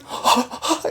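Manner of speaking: sharp startled gasp. Retold or imitated. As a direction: gasp as if caught off guard. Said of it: "she's like".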